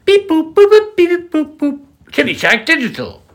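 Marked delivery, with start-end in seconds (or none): 0.1-1.8 very high beeping robot noises; beep boop jingle. Pretend. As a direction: chirp it like a jingle.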